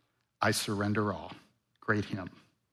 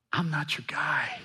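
whispered, breathy repeat; 2 semitones higher, 7 dB quieter than usual. Retold or imitated. Pastor voicing Moses whispering his refusal to God. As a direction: drop to a whisper. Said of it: "That's what he's saying".

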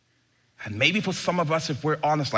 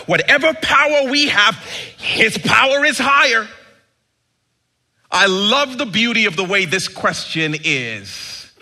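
loud, high, fervent sermon climax; preaching at full force; 8 semitones higher, 8 dB louder than usual. Acted. Pastor to his church on Christmas, full-force preaching. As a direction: lift the whole room with it.

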